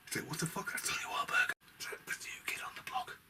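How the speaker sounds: hushed, disbelieving whisper; shocked whisper. Real, not acted. Reviewer reacting to [name]'s casting, in a shocked whisper. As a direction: drop to a whisper as if sharing a scandal.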